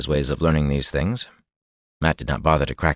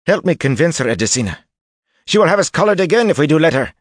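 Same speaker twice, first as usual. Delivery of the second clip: high, loud, desperate plea; urgent pleading; 12 semitones higher, 6 dB louder than usual. A narrator's reading. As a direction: beg for help, afraid.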